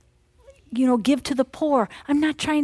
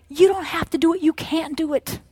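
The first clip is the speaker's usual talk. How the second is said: normal talking voice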